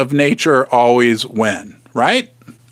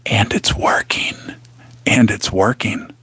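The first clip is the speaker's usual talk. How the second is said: hushed, breathy imitation of Joe; mocking whisper; 8 semitones lower, 4 dB quieter than usual. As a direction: whisper it like a secret into the mic. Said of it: "then he whispers into the microphone".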